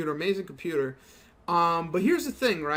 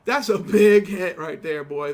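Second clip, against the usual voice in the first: hyped; 7 dB louder, slower than usual. Real, not acted. Reacting to a big game hit.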